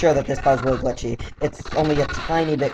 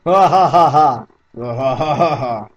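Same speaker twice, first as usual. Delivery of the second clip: hammed-up fake laughter; imagined hearty laughing. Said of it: "just imagine them ... just going".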